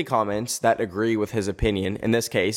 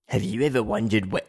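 put-on a failed stab at an Australian accent, lower; half-hearted Australian accent on the narrator's line; 3 semitones lower than usual. Mocking a YouTuber's intro in a botched Australian accent. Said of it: "I can't do an Australian accent".

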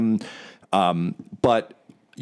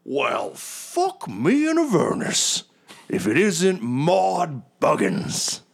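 booming high hearty hero voice, slow; a swaggering barbarian hailing an old rival; boisterous Magnuson; 8 semitones higher, much slower than usual. Acted. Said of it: "and he goes".

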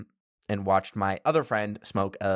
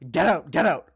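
get out; 6 semitones higher, 6 dB louder than usual. Retold or imitated. Friend shoos narrator away.

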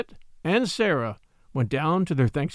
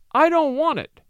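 high, louder mock-tearful protest; acted sobbing refusal; 10 semitones higher, 4 dB louder than usual. A narrator's reading. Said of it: "sobbed Sarah".

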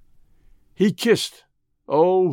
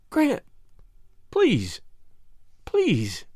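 pleading; 6 semitones higher, much slower than usual. A narrator's reading. Woman begs doctor to save lover.

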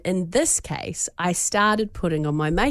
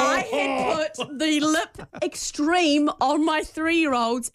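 high, slow shouted disbelief; yelled, slow, high-pitched horror at her own mistake; horrified yelp; 6 semitones higher, much slower than usual. Real, not acted. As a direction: shout it high as if you can't believe what you did. Horrified reveal to co-hosts.

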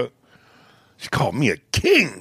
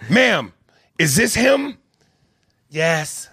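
cop voice; 7 semitones higher than usual. Retold or imitated. Acted scene voices.

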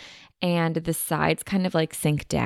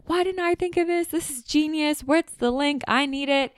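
excited fans; 9 semitones higher than usual. Retold or imitated. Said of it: "everyone, like, was like".

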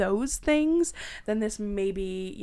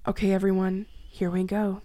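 usual speaking voice

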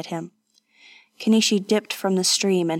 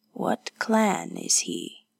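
slow, low, weary question; weak croak; 12 semitones lower, 4 dB quieter, slower than usual. Acted. Wounded woman asking about the warrior, weak croak. Wounded Hatsumi asks weakly.

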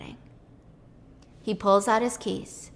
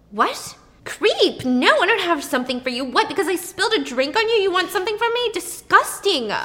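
high, loud disgusted scolding; angry rebuff; 8 semitones higher, 4 dB louder than usual. Retold or imitated. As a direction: tell off a creep. Waitress rejects pursuer angrily.

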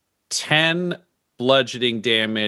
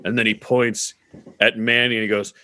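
ordinary talk, nothing marked

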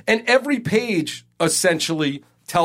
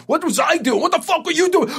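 higher, heated mock yell firing back at the workers; retold New York snap back; 12 semitones higher than usual. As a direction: throw the question back, pitch climbing in outrage. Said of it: "my stupid New York attitude".

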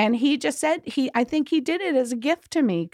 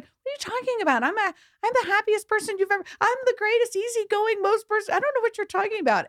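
mock outraged denial, much higher and fast; indignant protest; 12 semitones higher, faster than usual. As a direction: replay her own defensive disbelief, higher and faster. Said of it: "I was like".

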